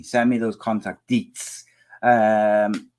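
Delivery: ordinary delivery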